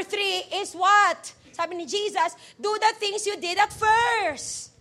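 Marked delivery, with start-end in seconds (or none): 2.6-4.7 high, slow scripture call; emphatic, drawn-out quoting; preacher's proclamation. Acted. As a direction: stretch the verse out for the congregation. Proclaims the verse.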